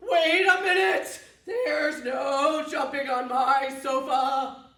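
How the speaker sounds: loud cross protest, slow; chicken's angry yell. Acted. Chicken yelling at the cows on his sofa. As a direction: shout like an indignant chicken.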